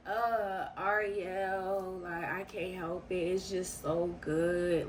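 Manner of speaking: low, slow, soft imitated moan; mock moaning man. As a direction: moan it out lazily like he's lost in it. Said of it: "he's saying that to me".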